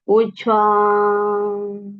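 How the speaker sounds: slow exhale cue on breath; drawn-out exhale. Acted. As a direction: let the word flow out.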